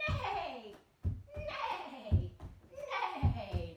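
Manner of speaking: loud, whinnying neighs; horse neigh. Pretend. Teacher kicking up as a horse saying hello, neighing loudly. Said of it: "a horse hello".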